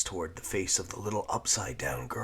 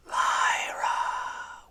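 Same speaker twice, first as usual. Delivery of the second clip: silken whisper; 6 dB louder than usual. A narrator's reading. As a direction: lure her with a silky whisper. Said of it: "whispered the enigmatic silken voice".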